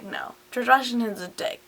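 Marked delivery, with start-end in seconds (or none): 0.0-0.3 very low, very soft, curt deep no; stern refusal. Acted. Washington coldly denies the condemned spy, deep voice. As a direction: drop into a deep, unmoved boss voice.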